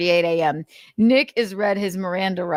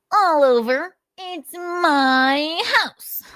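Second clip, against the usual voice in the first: high, slow whiny voice; Nick impression; 9 semitones higher, much slower than usual. Narrator voicing Nick answering the officer, high and whiny. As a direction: draw out my house in a squeaky mock voice. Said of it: "Nick's impression".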